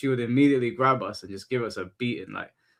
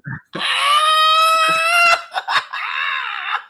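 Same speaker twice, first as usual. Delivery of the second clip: shrieking burst of laughter; loud high laugh; 5 dB louder than usual. Real, not acted. Realizing the clip was real, shrieking laughter.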